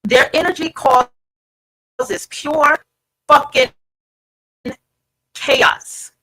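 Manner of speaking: pure fucking chaos punched loud and apart; emphatic, word by word. Real, not acted. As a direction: drive every word home so they hear you. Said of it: "I need you to hear me".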